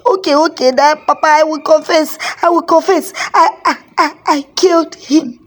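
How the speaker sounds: high, stammering frightened confession; panicked confession. A narrator's reading. Son confessing the murder to his father, high and frightened. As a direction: blurt it out high and scared. Confessing under ghost's flogging.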